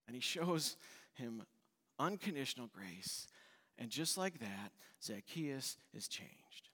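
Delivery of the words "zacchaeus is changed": slow hushed whisper. Real, not acted. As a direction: drop to a whisper, slowly. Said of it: "dramatic whispered climax".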